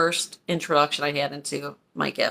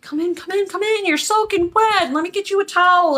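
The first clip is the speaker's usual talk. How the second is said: high-pitched, urgent welcome, acted out; fussing old lady; 13 semitones higher, 4 dB louder than usual. Old lady calling a soaking child inside, high fussing voice. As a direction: usher them in with high, hurried concern.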